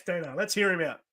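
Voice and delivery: plain reading voice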